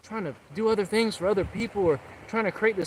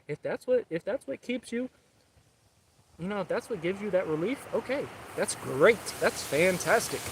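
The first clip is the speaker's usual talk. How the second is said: normal talking voice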